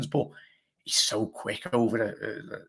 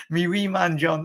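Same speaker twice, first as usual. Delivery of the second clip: put-on a light Scottish accent on me wee man, pitched up; 6 semitones higher, 3 dB louder than usual. Reading a Scottish viewer's message in a mock Scottish accent. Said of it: "I can just envision John speaking now".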